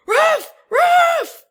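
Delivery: very high, very loud barked alarm cry; monkey alarm call. Acted. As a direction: bark it out like a monkey warning the troop. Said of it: "I yell".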